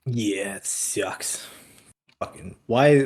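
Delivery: ordinary delivery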